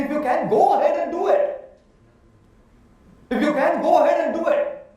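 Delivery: loud, high challenge to the audience; fired-up, laughing dare; challenging dare. Real, not acted.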